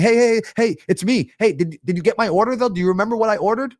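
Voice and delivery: high, fast, pestering panic; freaking out. Acted. Diner anxiously chasing the waitress about an order, freaking out. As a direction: play an anxious diner, pleading urgently. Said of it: "you're not freaking out saying".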